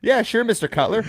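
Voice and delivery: high, sugary yes-man voice; mock polite reply. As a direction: play the eager employee. Retold exchange with boss.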